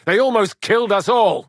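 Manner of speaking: loud, high and agitated; rattled, letting it burst out; shaken shout. Acted. Shaken after battle.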